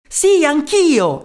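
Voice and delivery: high, loud, slow agreement; echoing cry. Acted. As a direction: shout along with the others. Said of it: "gli fece eco un'altra voce".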